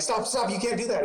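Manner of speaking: high-pitched mock-flustered parent; frantic parental scolding. Parents telling a swearing toddler off, mock frantic. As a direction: act out a parent pleading to stop.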